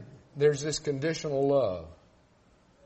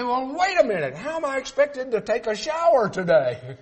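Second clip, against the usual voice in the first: raised, louder mock indignation; indignant outburst; 9 semitones higher, 6 dB louder than usual. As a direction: act out a shocked complainer. Preacher acts out complaining listener.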